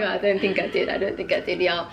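put-on American accent. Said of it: "talking in an American accent".

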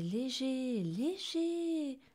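high, floating repeat of the word; light and floating. A narrator's reading. Lou relieved after being forgiven, light and airy. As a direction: let it float up as if weightless. Narrator acts out feeling light.

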